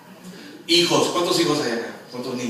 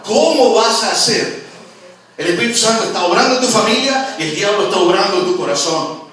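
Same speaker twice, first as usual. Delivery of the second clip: loud, high preacher's cry; fiery loud sermon climax; preaching at full force; 9 semitones higher, 9 dB louder than usual. Acted. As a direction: build it up and shout it to the church.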